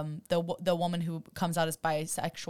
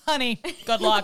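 patronizing little "honey, good luck"; sarcastic sympathy, louder; mock pitying; 3 semitones higher, 6 dB louder than usual. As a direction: pity them with a sarcastic smile. Mock advice to contestant.

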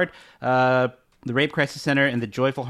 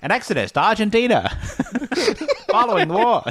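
put-on old-timey newsreel announcer; booming vintage newsreel voice; 1950s narrator; 9 semitones higher than usual. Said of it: "I love doing the voice".

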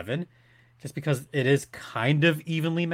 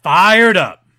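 loud, slow, hyped-up call; fired up; 4 semitones higher, 13 dB louder than usual. Real, not acted. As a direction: shout it to pump up the crowd. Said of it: "by doing what, J D?".

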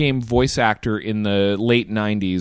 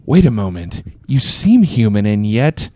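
hammed-up line, slow ending that jumps louder; stilted game-dialogue reading, drawn out at the end; mock-dramatic quote of a game heroine; Maria; 2 semitones lower, 6 dB louder, slower than usual. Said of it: "Maria with her".

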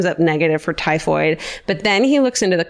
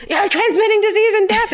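mock scream. A doctor screaming at the Irish cook. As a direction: yell it right in her face. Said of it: "screaming in her face".

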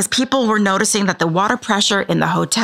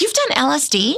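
astonished; 5 semitones higher than usual. Real, not acted. Hearing of his drug trips.

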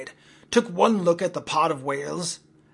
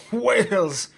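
a loud, higher cry of joy; first gleeful whoop, loud but held back; gleeful shout; 5 semitones higher, 6 dB louder than usual. Acted. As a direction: shout it out, delighted. Gleeful captain riding whale spout.

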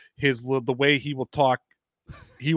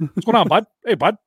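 hockey guy voice; 6 semitones higher, 6 dB louder than usual. Retold or imitated. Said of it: "like, Toronto hockey thing".

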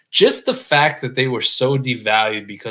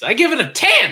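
high, loud Billy Bob shout; 13 semitones higher, 6 dB louder than usual. Retold or imitated. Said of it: "we are Billy Bob from Varsity Blues".

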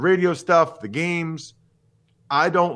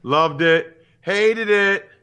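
sketch catchphrase; 6 semitones higher, 4 dB louder, much slower than usual. Retold or imitated. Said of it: "In Living Color back in the nineties".

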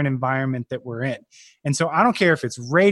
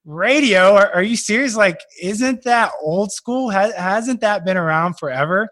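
mock disbelief, pitched up and louder; incredulous skeptic; 6 semitones higher, 4 dB louder than usual. Skeptics scoffing that radio is old school, incredulous. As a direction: play the doubter who scoffs at radio. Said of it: "here's the first people saying".

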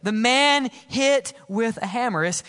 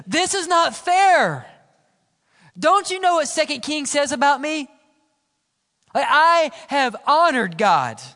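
indignant complaint; 5 semitones higher, 5 dB louder than usual. Retold or imitated. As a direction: voice a wounded king's outrage. Hezekiah's imagined complaint to Isaiah.

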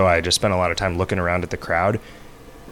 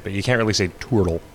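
ordinary talk

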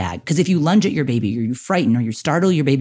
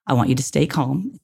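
normal talking voice

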